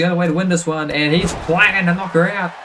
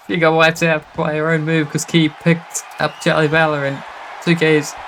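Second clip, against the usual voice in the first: normal talking voice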